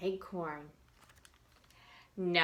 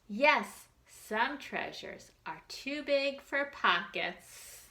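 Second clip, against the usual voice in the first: ordinary delivery, nothing marked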